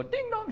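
high sing-song doorbell; two-note mock chime; doorbell imitation.

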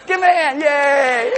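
high, loud cheer for the volunteer. Real, not acted. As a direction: whoop up the crowd for applause. Rallying audience to applaud.

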